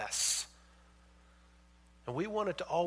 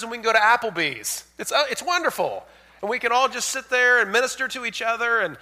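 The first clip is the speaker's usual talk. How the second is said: loud, high, driving sermon voice; full-force preaching; 8 semitones higher, 11 dB louder than usual. Acted. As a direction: preach it out to the whole church.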